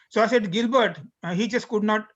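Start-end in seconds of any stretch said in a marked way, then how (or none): none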